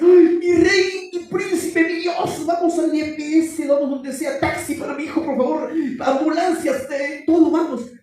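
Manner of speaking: high, loud hysterical mock mother; panicked mother. Pastor mocks a mother screaming for an ambulance over nothing. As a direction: shriek in a hammed-up panic. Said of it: "Y la mamá dice".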